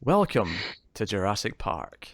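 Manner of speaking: put-on gentle old host welcome; Attenborough. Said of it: "Nailed it. Perfect".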